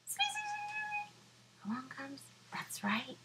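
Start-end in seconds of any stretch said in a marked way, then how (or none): 0.0-1.6 tiny squeaky squeeze. Acted. Mouse squeezing in.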